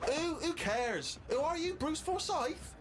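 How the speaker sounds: high, slow, scornful put-down; mocking girlfriend. Girlfriend dismissing him, retold.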